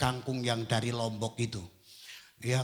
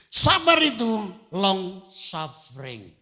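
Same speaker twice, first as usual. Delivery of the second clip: slow emphasis; 6 semitones higher, 6 dB louder, slower than usual. Acted. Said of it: "slow emphatic definition".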